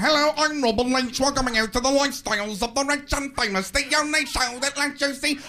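loud, high, shouting TV host voice; Robin Leach. Retold or imitated. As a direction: yell it like a hammy TV host. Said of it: "Robin Leach would come on screaming".

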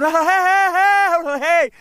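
very high, long, loud imitated yells; slow, stretched-out mock bellowing; retold frantic hollering. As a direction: holler wildly, imitating the man. Imitating man shouting unintelligible signals.

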